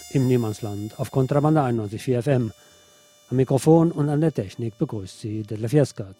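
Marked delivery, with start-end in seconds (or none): none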